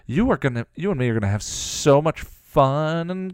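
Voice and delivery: high, loud, gloating villainess; relishing the torment to come; sadistic glee. A narrator's reading. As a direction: gloat with a cruel smile. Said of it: "Glory said with a sadistic smile".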